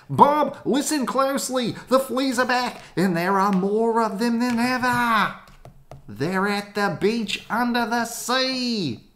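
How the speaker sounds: breathless high news tip; high urgent voice. Acted. Caller warning Bob about fleas, high and urgent.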